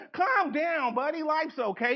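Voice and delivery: high, laughing patronizing comfort; mock condescending calm-down. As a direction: pat him on the head with words. Mock coworker calming fired colleague.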